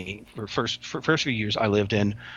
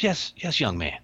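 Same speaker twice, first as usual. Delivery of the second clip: Love Kills impression; 3 semitones higher than usual. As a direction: imitate the old prison acquaintance answering. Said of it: "I speak like he speaks".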